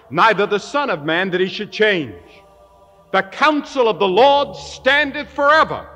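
full-force preaching. Acted. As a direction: thunder the verses out to the crowd. Quotes scripture at full force.

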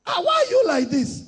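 high-pitched mock questioning; mock disbelief. Critic challenging a believer's way of dressing, mock disbelief.

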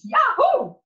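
very high, loud yahoo; loud high cheer; joyful whoop. Acted. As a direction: whoop with glee. Gleeful cheer in story.

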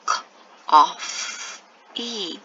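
"K, o, f, E" as separate phonic sounds with pauses; sounding out. Shown as a demonstration. Teacher putting coffee together for pupils, sounding out. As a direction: say "K, o, f, E" sound by sound, stretching "E". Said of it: "Put it all together".

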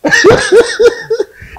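loud, very high run of laughter; high-pitched laugh. Real, not acted. Guest laughing over his own tale, high laughter. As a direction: burst into high, rapid laughter.

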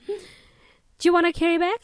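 bad Australian accent, rising at the end; put-on Aussie checkout operator asking; awful Australian accent. Shown as a demonstration. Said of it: "my awful Australian accent".

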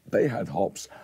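ordinary talk, nothing marked

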